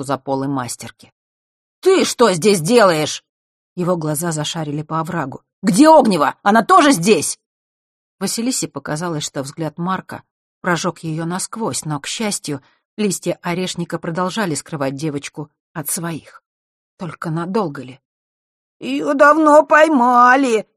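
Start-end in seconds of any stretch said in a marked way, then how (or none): 1.8-3.2 louder, higher, slow demand; stern demand. A narrator's reading. Catching the hiding boy. 5.6-7.4 loud, higher, pressing; urgent demand. A narrator's reading. Interrogating the caught boy. 18.8-20.7 high, slow whiny wail; cartoon crybaby. A narrator's reading. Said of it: "плаксивым голосом отозвался Мишка".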